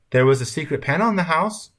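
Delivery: high, enticing grandmother's tale; crafty. A narrator's reading. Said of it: "she said craftily".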